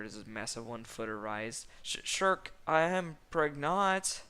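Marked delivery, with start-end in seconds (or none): 1.8-4.2 high, stammering, drawn-out confession; anxious donkey. A narrator's reading. Anxious donkey announcing news to Shrek, high and melodramatic. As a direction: blurt it out in hammed-up nerves. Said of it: "anxious donkey".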